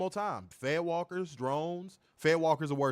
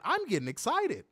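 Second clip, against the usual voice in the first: higher-pitched excited anime hero quote; Goku; 8 semitones higher than usual. Retold or imitated. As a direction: lightly imitate an eager cartoon fighter. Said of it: "What did Goku be saying?".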